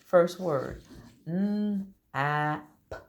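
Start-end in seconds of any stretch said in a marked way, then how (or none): 1.3-3.0 n, a, p said as separate sounds; segmented sounds. Shown as a demonstration. Teacher blending words for the class, sound by sound. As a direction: sound out n, a, p one by one. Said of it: "I'm gonna blend some words".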